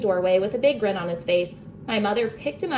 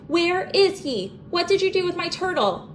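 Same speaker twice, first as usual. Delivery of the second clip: yelled; 9 semitones higher than usual. A narrator's reading. Boy yelling at his brother about the missing turtle. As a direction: yell it at the little brother. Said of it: "I yelled".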